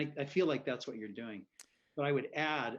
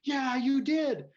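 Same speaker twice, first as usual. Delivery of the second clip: high, loud, drawn-out imitated shout; shouted agreement; 10 semitones higher, 8 dB louder, slower than usual. Cut-off man answering the apologetic driver, shouting. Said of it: "he's still shouting at me".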